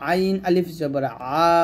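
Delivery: slow, squeezed-throat আ held long; pressed ain. Shown as a demonstration. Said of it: "আইনটা চাপ দিয়ে বলতে হবে".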